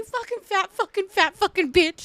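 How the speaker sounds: hammed-up maternal insult tirade; mad mom. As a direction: imitate a mom working up into cursing. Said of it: "she'd get all mad at you".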